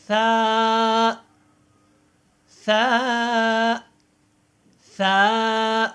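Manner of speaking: سا with the tongue between the teeth, gently, not pressed; soft tha. Shown as a demonstration. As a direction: say سا softly, tongue out slightly. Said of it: "نرمی کے ساتھ ادا کرتے ہیں". (سا کو نرمی سے، زبان دانتوں کے درمیان)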